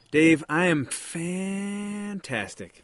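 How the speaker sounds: slow, drawn-out vowels like a sports ad; drawn-out announcer voice. Pretend. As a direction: stretch every vowel like an NBA commercial. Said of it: "that sounds like, uh, one of those N B A commercials".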